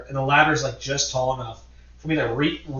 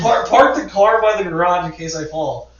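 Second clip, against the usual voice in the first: raised, higher holler from the roof; urgent yelled orders, played for laughs; calling out; 4 semitones higher, 5 dB louder than usual. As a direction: reenact shouting instructions down below.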